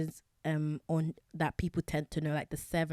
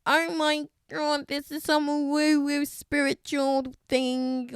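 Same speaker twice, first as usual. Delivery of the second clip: high, loud, slow mock dismissal; sarcastic high-pitched imitation; mocking skeptic; 10 semitones higher, 6 dB louder, slower than usual. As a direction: ham up a scoffing skeptic. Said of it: "you start thinking".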